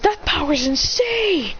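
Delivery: high, slow, awed exclamation; amazed. Acted. Onlooker stunned by the power, amazed. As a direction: gasp it out in disbelief. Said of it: "He says".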